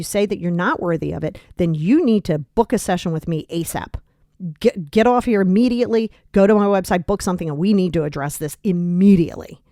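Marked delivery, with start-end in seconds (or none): none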